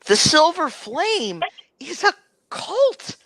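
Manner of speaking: very high, strained outburst; high-pitched character voice, indignant; outspoken accuser. Acted. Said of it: "he says that out loud".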